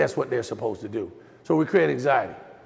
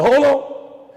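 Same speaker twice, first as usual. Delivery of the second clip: high, louder call to stop her; firm hold on; 10 semitones higher, 6 dB louder than usual. Retold or imitated. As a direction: cut her off firmly but not angry. Stopping his upset wife.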